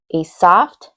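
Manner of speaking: ordinary talk, nothing marked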